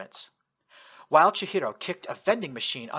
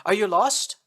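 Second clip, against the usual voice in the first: loud, high questioning call; 7 semitones higher, 6 dB louder than usual. Acted. Russian soldier calling out to the truck driver. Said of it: "the Russian called out".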